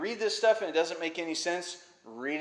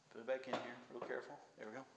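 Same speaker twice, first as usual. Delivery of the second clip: very soft, lower muttering; hushed aside to self; 4 semitones lower, 17 dB quieter, much faster than usual. Real, not acted. Preacher putting a prop back, muttering softly. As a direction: talk yourself quietly through a careful task.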